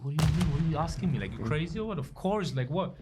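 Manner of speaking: incredulous. Retold or imitated. Player to his agent about joining Milan, incredulous. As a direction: retell it as if the question were crazy.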